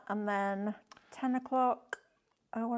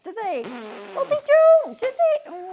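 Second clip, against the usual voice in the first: laughing protest; 9 semitones higher, 12 dB louder, slower than usual. Real, not acted. Laughing defence of drawing.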